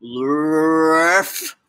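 drawn-out, swelling rubbery nonsense blurt; silly nonsense word. Pretend. Reader made to say every word, silly blurt. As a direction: stretch it into a silly noise.

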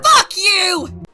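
high, loud yelled insult; furious shout. Acted. Shopper ending the soup quarrel, furious shout. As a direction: explode at the caller.

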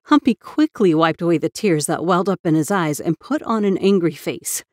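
plain reading voice

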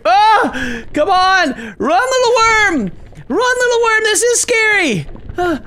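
high, squealing cries of alarm; hammed-up terror, stretched high words; panicked urging. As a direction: frantically urge it to flee. Urging worm to flee.

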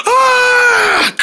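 high, held pretend scream. Fighter hit in play fight, high scream. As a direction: scream on one high note.

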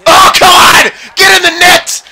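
high, loud pleading yell; frustrated shout. Real, not acted. Gamer begging the puck into the net, loud yell. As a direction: scream at the puck to go in.

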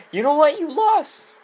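high, cocky taunt; mock trainer voice. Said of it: "then they're like".

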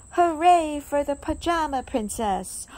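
loud, happy cheer. A narrator's reading. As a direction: cheer for the princess. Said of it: "cheering the princess".

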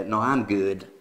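low-pitched American refusal; American accent. Acted. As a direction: decline casually as an American. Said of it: "L'americano direbbe".

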